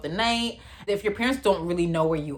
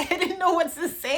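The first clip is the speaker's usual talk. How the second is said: laughing; 10 semitones higher than usual. Real, not acted. Laughing while retelling.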